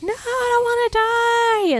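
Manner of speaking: high, hammed-up pleading wail; mock desperate plea. Retelling Scrooge seeing his coffin, mock desperate plea. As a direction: beg like he's about to die.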